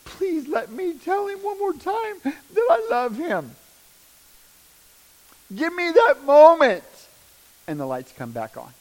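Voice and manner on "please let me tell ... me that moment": high, slow, tearful begging; crying plea to God. Retold or imitated. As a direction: beg God to spare your son. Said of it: "I'm screaming again".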